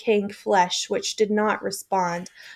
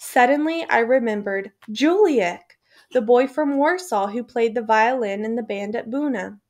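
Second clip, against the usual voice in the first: plain reading voice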